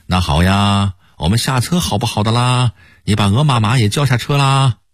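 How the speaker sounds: imitated Gang-Tai accent; Hong Kong-Taiwan accent. Shown as a demonstration. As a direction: put on a Hong Kong or Taiwan Mandarin accent, stretching the final particles. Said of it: "这是香港或者台湾人说的普通话".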